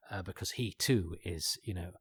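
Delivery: normal talking voice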